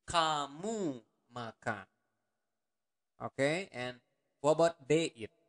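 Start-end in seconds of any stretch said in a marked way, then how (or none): 0.1-1.9 slowed demo. Shown as a demonstration. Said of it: "teacher models slow phrase".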